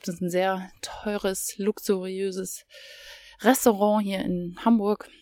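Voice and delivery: normal talking voice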